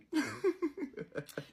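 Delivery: a high burst of laughter; real laugh. Real, not acted. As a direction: laugh at your own joke. Laughs after her mock line.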